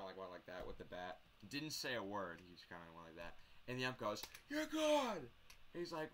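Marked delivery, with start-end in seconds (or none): 4.5-5.3 high-pitched umpire ejection yell; mock ump's shout, pitched way up; imitated umpire call. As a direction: call it out like an ump tossing a player.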